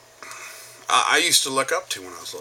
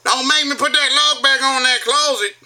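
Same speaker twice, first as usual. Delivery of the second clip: loud, high-pitched hillbilly scolding; Southern twang yell; 14 semitones higher, 6 dB louder than usual. Acted. Embarrassing his kids in public, mock Southern yell. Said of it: "get a kid's attention when you go".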